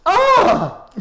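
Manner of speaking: a sudden high loud yelp, imitated for laughs; mock sharp yelp. Imitating the noise from the game room, a high mock yelp. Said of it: "like that".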